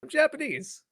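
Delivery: high, put-on YouTuber parody voice; Joey the Anime Man. Explaining who the parody was meant to be, high impression. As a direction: pitch it way up as a mimicked catchphrase. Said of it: "he was supposed to be Joey".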